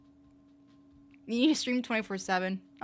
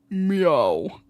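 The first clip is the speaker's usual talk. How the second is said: long, deep, loud meow; a drawn-out loud, deep meow; 3 semitones lower, 8 dB louder than usual. Retold or imitated. Streamer copying a YouTuber for fun, deep meow. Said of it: "when he did the deep meow".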